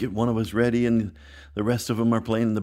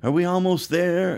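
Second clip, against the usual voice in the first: put-on a touch of higher whining kids; whiny kids; 7 semitones higher than usual. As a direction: whine it like bored children. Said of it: "we would argue and fight and yell and say".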